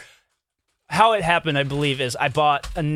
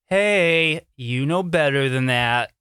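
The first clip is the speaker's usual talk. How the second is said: slow, restrained reproach to a dog; measured, disappointed telling-off; mild scolding; much slower than usual. Retold or imitated. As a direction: chide a misbehaving pet without yelling. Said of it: "when he does something bad".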